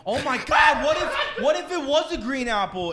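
Oh my God! burst out high and loud through laughter; laughing shock. Real, not acted. Shocked at the porn talk, cracking up loud and high.

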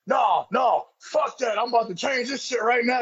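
high, emphatic retold protest without real shouting; Ron Simmons. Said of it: "You know how Ron is big fucking loud".

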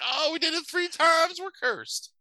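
very high, hammed-up wail; mock panic. Puppies realising they summoned the hound, squeaky mock panic. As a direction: squeal it in exaggerated dread.

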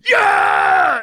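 long, high full-volume yell; overmodulated loud cheer. Retold or imitated. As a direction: imitate a fervent supporter screaming approval. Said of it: "it's, like, overmodulated and loud".

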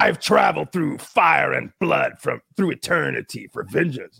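slow, lightly voiced fantasy god; elder god. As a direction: intone it a little like an ancient avenger. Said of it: "the guy's like".

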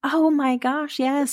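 light up as you recognise him; excited recognition. Real, not acted. Recognising Lenny James's Walking Dead role.

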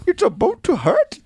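put-on "boat" with a slipped Indian accent; it's a boat, drifting into an Indian accent; accidental Indian accent. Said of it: "I sounded Indian there for a second".

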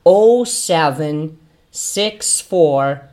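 teacher modelling a phone number slowly; model digits. Shown as a demonstration. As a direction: read "Oh seven six four" digit by digit. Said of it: "as pessoas dando um telefone falam".

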